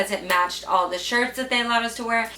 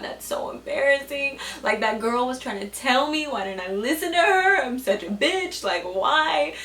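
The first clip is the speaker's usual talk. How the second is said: higher-pitched whine of self-reproach; mortified wail; 4 semitones higher than usual. Retold or imitated. Girl berating herself in a school bathroom, mortified whine. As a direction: wail it, replaying the embarrassment.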